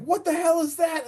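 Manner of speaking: very high-pitched mock panic; imitated panicked cry. Heather fleeing into the woods, imitated panicked cry. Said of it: "screaming, absolutely screaming".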